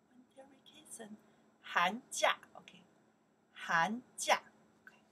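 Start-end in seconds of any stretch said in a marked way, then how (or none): 1.6-2.4 寒假 called out loud, rising; model pronunciation. Shown as a demonstration. Teacher drilling 寒假 for the class, loud. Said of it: "we pronounce here". 3.5-4.4 寒假 called out loud, rising; model pronunciation. Shown as a demonstration. Teacher drilling 寒假 for the class, loud. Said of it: "we pronounce here".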